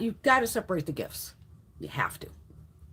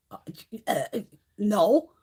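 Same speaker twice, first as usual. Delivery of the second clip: halting, stumbling mock answer; mock stammering; slower than usual. Boss answers the notice question, mock stammering. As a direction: stall before admitting it. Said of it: "you keep stuttering".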